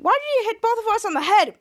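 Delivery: high, demanding accusation; mock indignant question. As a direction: act out an aggrieved complaint.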